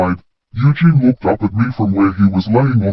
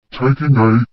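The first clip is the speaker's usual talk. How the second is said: ordinary delivery, nothing marked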